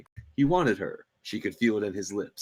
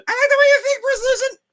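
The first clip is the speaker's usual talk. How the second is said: high princess voice; 8 dB louder, faster than usual. Acted. [name]'s voice.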